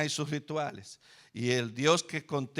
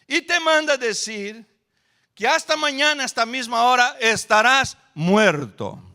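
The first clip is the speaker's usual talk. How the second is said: loud, high threat, slowing at the end; furious threat; 8 semitones higher, 6 dB louder than usual. Retold or imitated. Messenger delivers the queen's threat to Elías, loud and menacing. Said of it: "llega el mensajero y dice".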